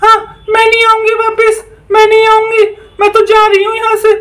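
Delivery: very high witch voice giving in; a beaten witch promising to go; defeated witch. Acted.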